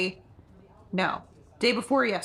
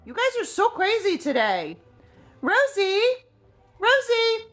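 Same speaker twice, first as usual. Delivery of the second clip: high sing-song coo at the dog; pet talk; 14 semitones higher, 4 dB louder than usual. Real, not acted. Owner coaxing her dog Rosie to say hi, high cooing. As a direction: coo up high like calling a puppy over.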